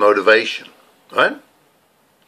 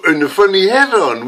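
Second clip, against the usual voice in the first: comic character voice; 9 semitones higher, 4 dB louder than usual. Acted. Comedy character greeting, high voice.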